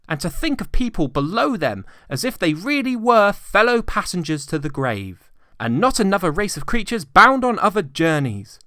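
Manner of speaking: high, loud, fervent plea; impassioned speech. A narrator's reading. Nephew defending Christmas to Scrooge, high fervent speech. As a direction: build to a heartfelt blessing.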